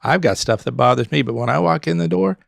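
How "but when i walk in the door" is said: ordinary delivery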